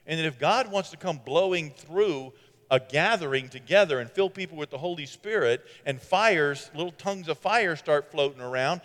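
ordinary delivery

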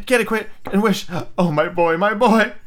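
high, breathless desperate urging; panting. A narrator's reading. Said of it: "she panted".

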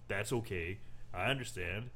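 low, quiet and soothing; a hint of a calm, deep Chef. Chef calmly answers the mom.

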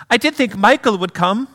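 put-on high British lady's accent; Pam. Mother expecting her son, high British accent. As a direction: play a disappointed British mother, pitched high. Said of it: "do a little accent work".

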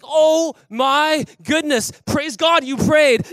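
loud, slow stretched amazement; amazed shout. Retold or imitated. Drummer amazed his wallet came back, loud and slow. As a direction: shout it out in disbelief. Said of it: "I was like".

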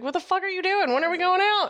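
mock-furious friend on the phone, high and indignant; high mock outrage. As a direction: shriek it like an offended friend. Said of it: "I was like".

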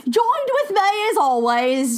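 lightly put-on British accent, slow. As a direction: play a posh English host, unhurried. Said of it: "my very famous British accent indeed".

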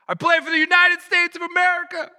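high, loud all-out shout; acted athlete's climactic shout; triumphant yell. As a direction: bellow it with patriotic pride. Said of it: "he yells his name".

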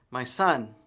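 a firm call to his son. Acted. Blind father calls to his son.

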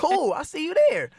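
hammed-up back-and-forth, high and bright in pitch; mock excited chat. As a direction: play an eager fan and a hyped artist trading messages. Acts out fan DM exchange.

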